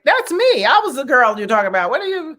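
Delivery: high, indignant challenge; confronting him. Retold or imitated. Girl confronting the man over the bushes, high and indignant. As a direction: call him out for what he said, pitch way up. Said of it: "And I was like".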